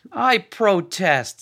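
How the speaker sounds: high, slow playful objection; smiling protest. A narrator's reading. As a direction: protest with a smile, teasing. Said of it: "with a smile to counter Shirkahel's half-smile".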